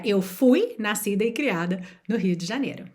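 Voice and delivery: ordinary talk, nothing marked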